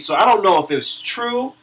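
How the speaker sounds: ordinary talk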